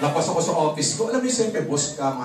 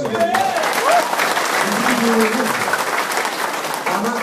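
loud, high, drawn-out sales hype; emphatic boast; 5 semitones higher, 6 dB louder, slower than usual. Real, not acted. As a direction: sell the big number hard. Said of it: "pitching success proudly".

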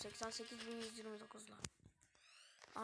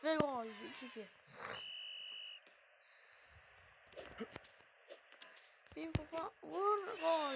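excited goal call; 2 semitones higher, 4 dB louder than usual. Real, not acted.

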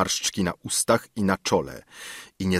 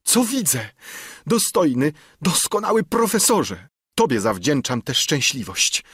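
put-on much higher, ecstatic young suitor's voice; overjoyed Tusman; 5 semitones higher than usual. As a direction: gush your thanks to the professor, high and bright. Narrator voices grateful Tusman.

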